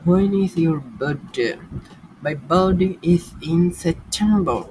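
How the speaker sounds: slow, careful classroom English; slow drill. Shown as a demonstration. Teacher models a question for students, slowly. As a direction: spell it out slowly for beginners. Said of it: "slow drill for learners".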